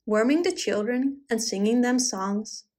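plain reading voice

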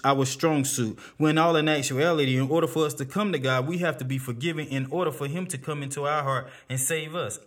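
usual speaking voice

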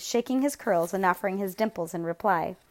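usual speaking voice

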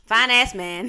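loud laughing echo. Real, not acted. Laughing repeat of friend's words.

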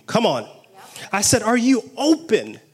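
ordinary talk

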